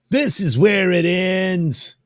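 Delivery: high, loud, drawn-out declaration; high character threat. Acted. Confronting an enemy in the comic, high drawn-out threat.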